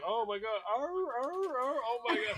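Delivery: high-pitched, yelping mock panic; frantic dog impression. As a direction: play an overexcited dog yelping. Acting out the excited dog running up.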